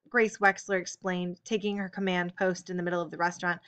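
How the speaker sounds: ordinary delivery, nothing marked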